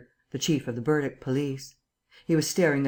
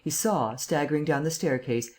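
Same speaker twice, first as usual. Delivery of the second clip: plain reading voice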